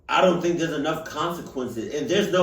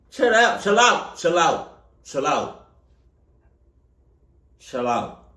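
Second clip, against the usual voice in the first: fading chill out; 4 dB louder than usual. Real, not acted. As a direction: urge them, then let it fade. Pleading to chill out.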